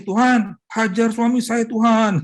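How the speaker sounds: high, loud vengeful plea; vengeful prayer. Retold or imitated. Wife praying aloud against her husband, high and loud. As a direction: pray for God to strike him, loud and high. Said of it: "Tapi doanya yang gini".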